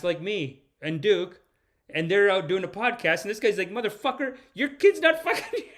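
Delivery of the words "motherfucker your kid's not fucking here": high-pitched ranting, breaking into laughter; hammed-up indignant outburst; mock outrage. Streamer voicing the soldier cursing an oligarch, laughing mock rage. Acts out the man raging at oligarchs.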